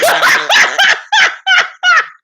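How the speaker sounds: loud, high cackle; bursting out laughing. Real, not acted. Host cracking up at own impression, loud high laughter. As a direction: lose it completely in a shrieking laugh.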